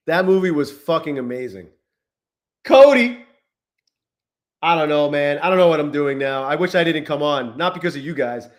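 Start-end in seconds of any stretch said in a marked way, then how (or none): 2.6-3.2 loud, high shout of a name; calling out. Real, not acted. Streamer shouts to Cody off-screen, loud and high. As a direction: yell across the room to get attention.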